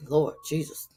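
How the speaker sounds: usual speaking voice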